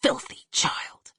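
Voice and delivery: slow, hissed disgust; harsh mother. A narrator's reading. Mother sneers at her child. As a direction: spit out the contempt.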